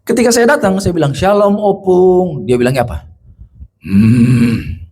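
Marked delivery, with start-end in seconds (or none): 1.1-2.5 long, slow, higher-pitched hello; drawn-out greeting. Retold or imitated. Visitor greets the bedridden grandmother, drawn-out greeting. Retold greeting to elderly patient. 3.8-4.8 low, rasping drawn-out hum; hoarse possessed hum. Retold or imitated. Possessed old woman answers the visitor, hoarse hum. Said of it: "Suara orang serak batuk?".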